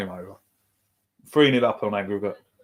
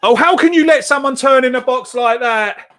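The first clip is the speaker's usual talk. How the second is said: much higher, louder exasperated cry; frustrated outburst; 11 semitones higher, 4 dB louder than usual. Real, not acted. Fan watching his team concede space, frustrated outburst. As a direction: cry out at the defending in disbelief. Fan reacting to a defensive error.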